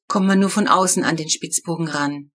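ordinary delivery, nothing marked

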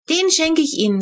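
put-on high, eager child's voice; a small girl proudly giving a present; little Flo. A narrator's reading. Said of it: "sagte sie".

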